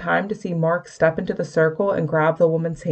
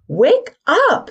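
much higher, louder yell; 13 semitones higher, 5 dB louder than usual. A narrator's reading. Stormy yelling at Mark's limp body. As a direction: scream it at him to wake. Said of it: "Stormy yelled".

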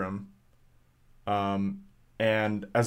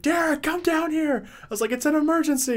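calling out. Retold or imitated. Calling her roommate down to see the bird, high call. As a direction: call him down the stairs.

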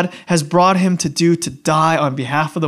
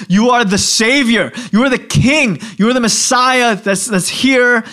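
higher, emphatic acclaim; acted-out fervent acclaim; excited proclamation; 5 semitones higher, 3 dB louder than usual. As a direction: declare it as a thrilling revelation. Disciples acclaim Jesus as Messiah.

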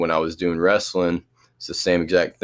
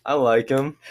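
higher-pitched, halting answer; hesitant, stuttered; 7 semitones higher than usual. Real, not acted. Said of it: "sounded like a very stuttered".

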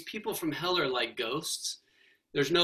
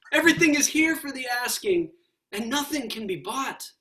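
higher, louder, imploring; urgent plea; 8 semitones higher, 6 dB louder than usual. Acted. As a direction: beg him to just ask. Solid person urges the ghost.

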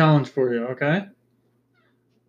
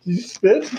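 ordinary talk

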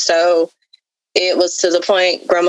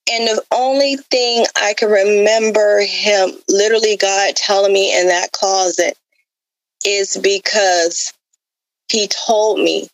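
usual speaking voice

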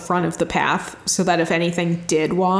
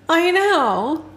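ordinary talk

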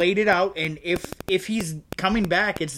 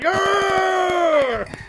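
frustrated yell; 14 semitones higher, 4 dB louder than usual. Retold or imitated. Fans reacting to dice theory.

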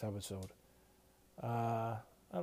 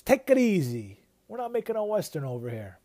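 higher, louder stern Junior; mock stern shutdown; 7 semitones higher, 8 dB louder than usual. Junior shutting down Mikey, mock stern and louder. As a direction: boss shutting down a hothead, hammed. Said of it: "Junior shuts him down".

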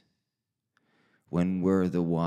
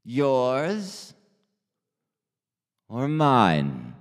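loud, slow, with long pauses; loud, drawn-out put-down with dramatic pauses; pointed confrontation; 2 semitones higher, 7 dB louder, much slower than usual. Acted. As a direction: spell it out slowly and loudly, one piece at a time. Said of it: "And him going".

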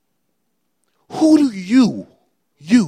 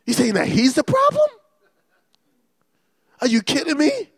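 ordinary talk

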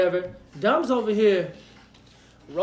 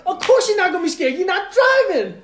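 sarcastic retort; 10 semitones higher, 6 dB louder than usual. Retold or imitated. Retorting to drunk friend.

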